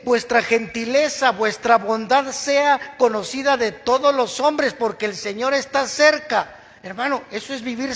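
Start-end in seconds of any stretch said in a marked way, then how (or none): 0.0-6.6 loud, high, slow preaching of the verse; preaching at full force. Acted. Preacher urging the congregation to rejoice, loud and slow. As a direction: proclaim it to the whole church.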